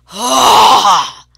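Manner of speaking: loud bear-like growl at full force; a startled, bellowing growl in the dark. Retold or imitated. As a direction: roar it out to scare off what's ahead. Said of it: "So I growled".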